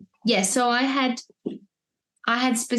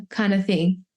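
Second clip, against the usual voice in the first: ordinary delivery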